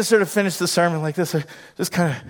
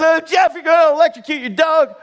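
high, alarmed cry to a friend; alarmed protest; 11 semitones higher, 4 dB louder than usual. Retold or imitated. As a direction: cry out in acted panic for the dog. Acts out own alarm at friend.

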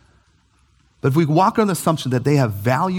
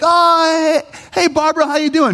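bright, much higher, louder hello to a cashier; cheery greeting; 13 semitones higher, 4 dB louder than usual. Retold or imitated. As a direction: sing out her name like an old friend. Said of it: "I'm like".

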